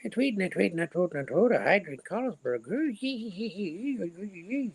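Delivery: very high, squeaky mock Irish drunk. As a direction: pitch way up into a tiny squeaky Irish voice. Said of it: "your little squeaky Irish voice".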